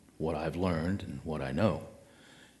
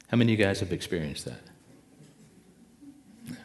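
normal talking voice

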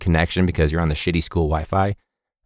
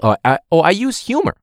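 mocking presenter; 8 semitones higher than usual. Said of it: "But saying, like".

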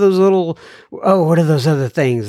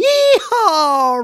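cowboy yeehaw; 13 semitones higher than usual. Acted. Cowboy cheer for Arkansas listener.

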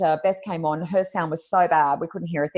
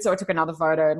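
usual speaking voice

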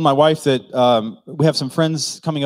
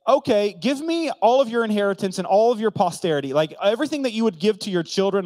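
high, emphatic mock demand; sarcastic reading; 7 semitones higher, faster than usual. Preacher paraphrasing Jacob's demand for the birthright, sarcastic. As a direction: pile it on, sarcastically insistent. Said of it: "it's almost like a sarcastic reading".

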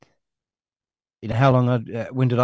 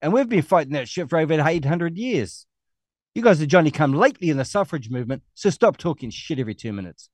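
usual speaking voice

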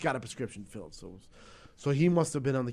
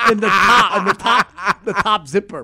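loud, higher, laughing through the words; 6 semitones higher, 13 dB louder, slower than usual. Real, not acted. Telling the podcast host, laughing loudly. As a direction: crack up while telling it.

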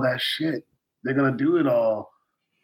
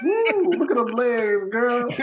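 much higher, whooping catcall; mock catcaller; 11 semitones higher, slower than usual. A podcaster joking about skinny TVs, playful high-pitched catcall. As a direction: hoot at her legs like a street admirer.

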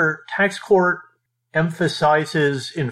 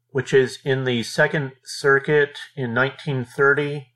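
ordinary delivery

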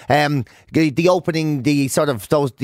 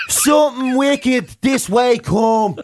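slow, high, hammed-up regional Macbeth; mock local-accent Shakespeare; 6 semitones higher, slower than usual. Actor doing Macbeth at the Abbey, hammed-up local accent. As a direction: intone it broadly in a put-on local accent. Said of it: "it's a different show".

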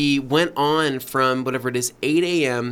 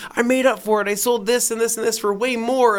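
higher defensive explaining; defensive wife; 8 semitones higher than usual. Retold or imitated. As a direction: justify the sale. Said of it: "She goes".

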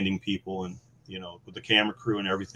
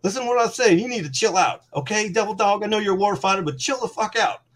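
higher put-on voice of a stern sergeant major; imitating sergeant major; 11 semitones higher than usual. Sergeant major telling the angry Marine to chill, hammed-up stern voice. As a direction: lay down the law like a senior NCO. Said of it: "He's like".

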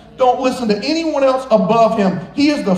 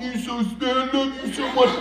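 cooing to a dog; 3 semitones higher, 6 dB quieter than usual. Retold or imitated.

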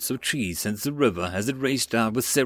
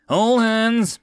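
high, loud rallying call; calling all hands; 10 semitones higher, 6 dB louder than usual. A narrator's reading. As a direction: call out to the entire crew. Skipper calling the whole crew.

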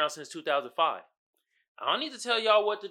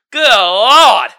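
loud exclamation; 2 semitones higher, 16 dB louder than usual. Real, not acted. Recovering from laughing.

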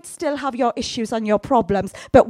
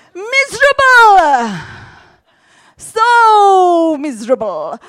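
louder, slow, wailing miserable; milk the misery with a long drawn wail; exaggerated misery; 5 semitones higher, 8 dB louder, slower than usual. Preacher hamming up misery to the congregation. Answers own question dramatically.

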